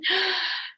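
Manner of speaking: quiet, audible inhale; soft gasp of air; sharp breath in. Shown as a demonstration. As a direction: draw a quick breath in. Demonstrating going in with a breath.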